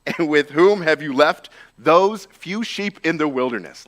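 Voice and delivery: slow, loud scornful rebuke; angry older brother. A narrator's reading. Older brother angrily rebukes young David. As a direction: scold him like a furious elder brother. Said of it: "Eliab's anger was kindled against David and said".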